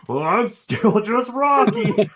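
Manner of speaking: high, slow comic moan; high-pitched cartoon sad-sack voice; the Thing. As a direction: drag it out as a mock-pathetic lament. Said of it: "the Thing's just like".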